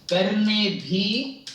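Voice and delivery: करने भी drawn out very slowly; very slow recitation. Shown as a demonstration. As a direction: stretch करने भी word by word. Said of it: "plane pronunciation". (बहुत धीरे उच्चारण)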